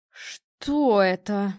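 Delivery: very slow, drawn-out shock; freeze, stretching each word in disbelief; stunned. A narrator's reading. Mother freezing in the doorway at the dog, stunned.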